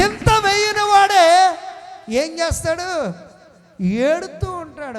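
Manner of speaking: loud, slow, high preaching call. Acted. Pastor warns the worrier will weep, slow loud preaching. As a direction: stretch each word out and fill the hall.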